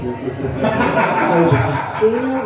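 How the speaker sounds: normal talking voice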